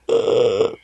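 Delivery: choked pained gasp, replayed; acted-out gasp of pain; re-enacted gasp. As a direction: imitate a gasp too sore for words. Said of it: "I, I gasped".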